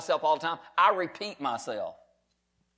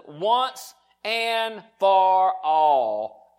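emphatic slow preaching; 4 dB louder, much slower than usual. Real, not acted. Preaching at full force.

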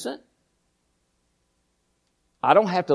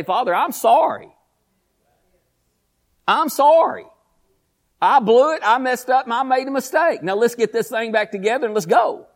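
earnest plea; 5 semitones higher than usual. Shown as a demonstration. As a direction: pour it out to God, then pick yourself up. Demonstrating a heartfelt repentance prayer.

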